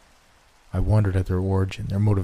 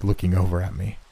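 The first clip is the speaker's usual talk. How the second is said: plain reading voice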